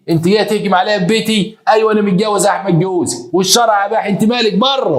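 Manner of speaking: high, defiant retort; indignant confrontation. Retold or imitated. Legal wife confronts woman invading her home, high indignant retort. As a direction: act out a wife standing her ground.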